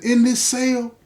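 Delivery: a broken father pressing his son, rising to full force; father's anguished plea. Retold or imitated. Father begging his son in the cell, swelling anguished plea.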